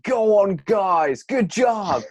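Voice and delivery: loud American swimmer's cheer, mimicked; imitated positive shouting. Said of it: "when he was shouting that".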